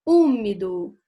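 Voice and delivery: "Úmido" with a loud, long Ú; stress on the first syllable. Shown as a demonstration. Teacher showing a proparoxytone, Ú loud and held in "Úmido". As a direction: hit the "Ú" of "Úmido" hard. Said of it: "é o u".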